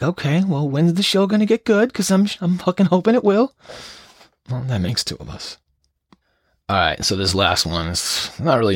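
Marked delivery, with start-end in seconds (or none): 0.0-3.5 higher, mock-whiny impatient listener. Imagining a bored listener, mock whiny and higher. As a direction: pitch it up like a bored fan wanting more. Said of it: "you're probably like".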